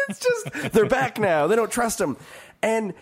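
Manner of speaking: normal talking voice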